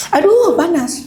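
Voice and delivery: high, laughing through the burn; laughing with a hot mouthful. Real, not acted. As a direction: giggle while the food scalds. (tertawa sambil kepanasan)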